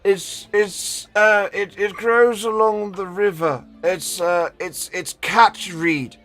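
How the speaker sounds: put-on high, slightly slow old man. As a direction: play a doddery old wizard. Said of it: "Generic old man voice one".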